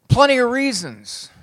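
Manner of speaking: normal talking voice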